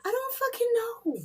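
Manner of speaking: mock answering voice. Answers her own question, mock voice.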